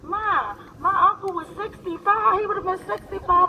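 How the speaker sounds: put-on a touch of a young daughter. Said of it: "She like".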